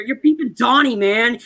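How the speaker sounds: playful mock scold; mock accusation. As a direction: call him out with a grin. Mocking character's creepy move.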